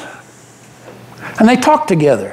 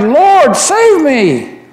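desperate cry; 8 semitones higher, much slower than usual. Acted. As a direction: shout for help while sinking in the waves. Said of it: "I cried".

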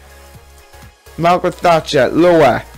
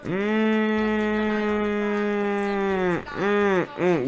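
high, tight hums of pain; pained humming; 7 semitones higher, 7 dB quieter, much slower than usual. Real, not acted. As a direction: hum through gritted teeth. Pegs pinching, enduring pain.

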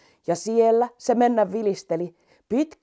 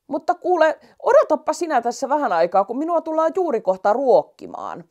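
put-on louder, chatty old lion; 6 semitones higher, 4 dB louder than usual. Old lion telling the mouse to wait for Sanna, louder. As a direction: ramble as a forgetful old lion.

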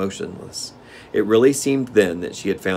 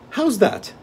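Gnome King voice; 14 semitones higher than usual. Acted.

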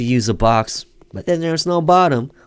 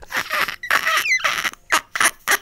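shrill cracking laugh; 3 dB quieter than usual. Real, not acted. Laughing at own scream.

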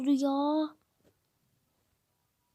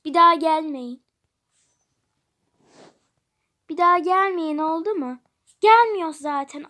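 loud shouts of "Bir daha gelmeyin"; furious shop worker bellowing; angry shout; 9 dB louder than usual. Acted. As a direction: yell at them to never come back. Angry cashier shouting them off.

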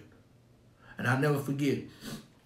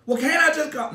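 much higher, louder, protesting son; whining son; 13 semitones higher, 5 dB louder than usual. Retold or imitated. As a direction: squeal it like a son who got caught.

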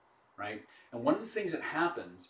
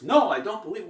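loud, higher indignant shout; disbelieving outburst; 7 semitones higher, 9 dB louder than usual. Retold or imitated. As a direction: blurt it out loud in open disbelief. Heckler insists again.

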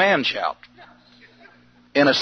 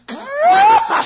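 high drawn-out shout; 3 dB louder than usual. Retold or imitated. Shouting again at station.

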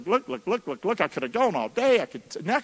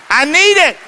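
loud; 10 semitones higher, 12 dB louder than usual. Real, not acted. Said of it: "repeating for emphasis".

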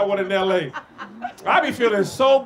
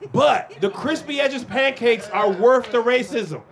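normal talking voice

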